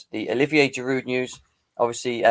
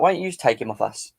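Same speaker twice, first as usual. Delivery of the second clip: ordinary talk, nothing marked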